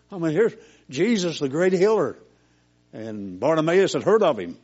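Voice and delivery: loud, high preaching build; fiery preaching. Acted. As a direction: preach it out to the congregation, full voice. Preaching at full force.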